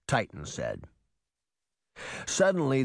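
plain reading voice

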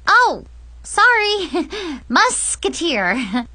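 high, slow, embarrassed giggle; sheepish high apology broken by a giggle; giggly apology. Acted. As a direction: laugh off the slip, drawing the words out. Girl giggles, apologising for slip.

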